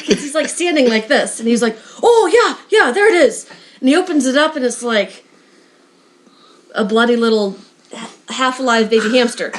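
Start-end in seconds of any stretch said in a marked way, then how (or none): 2.0-3.4 high-pitched mock 'oh yeah' of remembering; sudden realisation. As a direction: jump up in pitch, retelling a happy discovery. Barry suddenly finds the hamster.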